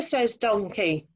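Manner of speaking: usual speaking voice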